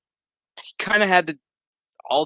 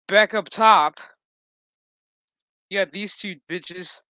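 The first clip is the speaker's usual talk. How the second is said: ordinary talk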